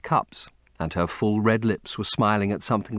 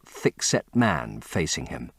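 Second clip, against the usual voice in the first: plain reading voice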